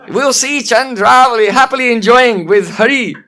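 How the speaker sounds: loud mimicking monkey voice, hammed-up; funny mocking voice. Old monkey repeats Padma's words to tease her, mocking. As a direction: parrot a proclamation in a silly, hideous voice. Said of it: "but in a funny way".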